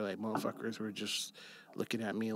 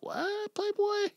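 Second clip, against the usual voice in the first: surprised; slower than usual. Retold or imitated. Retelling his own surprised reply.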